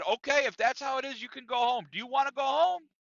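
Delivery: high-pitched, insistent boss's ultimatum; Chuck. Retold or imitated. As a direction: act out a fed-up boss giving a warning. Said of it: "And then Chuck said".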